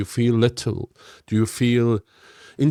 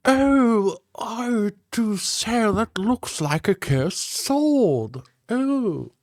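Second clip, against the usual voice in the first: much higher, very slow pompous nasal-posed wizard voice; snooty old wizard; 10 semitones higher, much slower than usual. Acted. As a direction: marvel at the sword like a haughty old mage. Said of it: "I'm leading with the nose".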